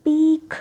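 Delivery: पीक said loud with a long ई and a crisp final क; clear demo word. Shown as a demonstration. Teacher modelling the word for students, loud and crisp. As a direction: model पीक slowly for learners. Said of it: "यहाँ देखिए पिक".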